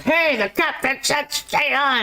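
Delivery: mock-shout the order to stay on board; mock yelling. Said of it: "everyone's like".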